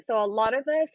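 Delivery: usual speaking voice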